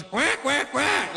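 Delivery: high-pitched challenging duck quacks; duck quacking. Retold or imitated. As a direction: quack like a male duck squaring up. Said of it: "and he does, he goes".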